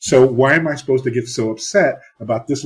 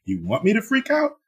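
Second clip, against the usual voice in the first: higher, mocking questions; sarcastic challenge; 9 semitones higher than usual. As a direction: needle him with sarcasm. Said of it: "I was like".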